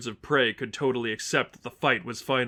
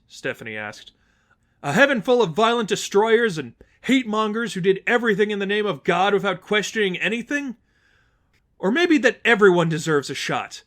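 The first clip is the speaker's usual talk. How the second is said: higher, louder questioning rebuke; Stephanie, challenging; 7 semitones higher, 6 dB louder than usual. Acted. Stephanie challenging the worshippers, pointed rebuke. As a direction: make them doubt their faith.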